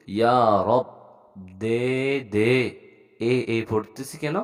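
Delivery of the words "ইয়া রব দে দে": ইয়া রব, দে দে word by word with held vowels; pronunciation demo. Shown as a demonstration. Teacher showing the vowelless last letter, ইয়া রব demo. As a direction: stretch ইয়া and each দে, stop রব on b. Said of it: "তো আমি বলি".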